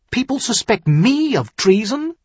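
high, sharp indignant question; sharp demand. Acted. Son-in-law hears he is suspected of treason, sharp demand. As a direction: demand it in offended disbelief, pitch leaping. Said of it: "Tyrion demanded sharply".

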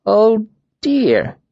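mock dismay. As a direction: overplay the fake pity. Mocking sympathy, repeated.

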